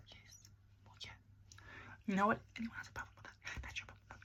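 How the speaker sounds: soft conspiratorial whisper; whispered sassy aside. Acted. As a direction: hush it like a secret to the camera.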